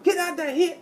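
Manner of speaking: very high, strained Irish impression; put-on squawky Irish trash talk; mock Irish accent. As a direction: heckle in a bad Irish accent. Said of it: "almost start talking in an Irish accent".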